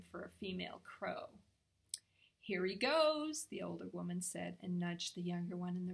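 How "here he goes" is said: high, loud, stretched-out remark; slow, swooping delight; amused anticipation. A narrator's reading. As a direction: announce the show starting. Woman watching crow's act begin.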